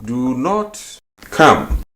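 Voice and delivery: slow teacher gloss; slow, emphatic meaning. Shown as a demonstration.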